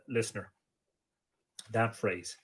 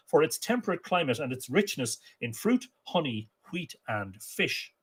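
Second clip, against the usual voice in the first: lively, slightly raised storytelling read; story narration; 7 semitones higher, 6 dB louder than usual. A narrator's reading. Storyteller reading an Irish myth to listeners, lively.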